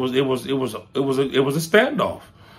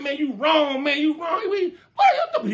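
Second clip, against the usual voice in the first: much higher, laughing plea; laughing protest; 12 semitones higher than usual. Retold or imitated. Laughing high plea to a friend lowering his gun. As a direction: squeal through laughter, begging him to stop.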